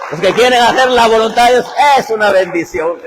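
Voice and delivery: loud, high fiery preaching; soaring pulpit cry; preaching at full force. Real, not acted. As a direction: lift the crowd with it.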